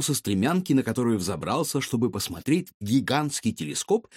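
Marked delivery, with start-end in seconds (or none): none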